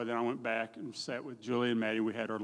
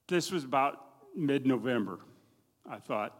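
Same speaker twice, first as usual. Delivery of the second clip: usual speaking voice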